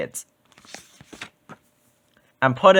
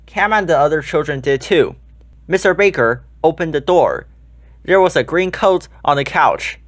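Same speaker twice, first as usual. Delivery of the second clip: usual speaking voice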